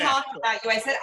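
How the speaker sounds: ordinary delivery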